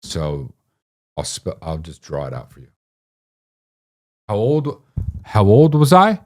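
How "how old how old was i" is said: pitched way up, rising question; insistent quiz. Real, not acted. Quizzing Mitty on the drawing, high and insistent. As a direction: prompt sharply in a high, rising voice.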